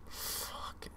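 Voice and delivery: breathed out under the breath; voiceless frustrated curse; whispered curse. Real, not acted. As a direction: hiss the swear to yourself. Muttered curse after a goal.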